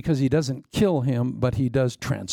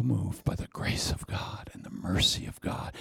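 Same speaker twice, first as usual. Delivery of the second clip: awed whisper; 7 semitones lower, 8 dB quieter than usual. Acted. Awe at God's mercy.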